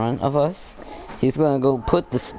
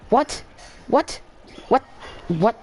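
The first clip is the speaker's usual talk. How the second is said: sharp high disbelieving whats, the first two much higher; shocked the jump failed, repeat it in disbelief; incredulous what; 13 semitones higher than usual. Real, not acted. Character fails a jump in game.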